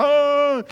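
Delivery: loud, high "oh" imitating crying; hammed-up bawling cry; mock wail. Imitates own crying among praying people.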